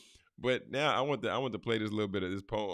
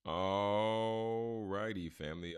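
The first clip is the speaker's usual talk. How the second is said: slow stretched all righty; drawn-out greeting; much slower than usual. Real, not acted.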